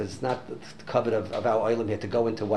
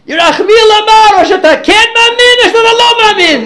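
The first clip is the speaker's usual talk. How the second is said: screaming; 17 dB louder than usual. Retold or imitated. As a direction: scream the ultimatum at the woman. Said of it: "my new friend is screaming at the lady".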